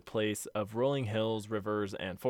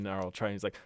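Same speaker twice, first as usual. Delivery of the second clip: normal talking voice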